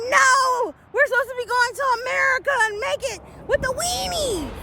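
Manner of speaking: high, pleading mock complaint; high, whining protest at the ending; whiny protest. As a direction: whine it like a let-down fan.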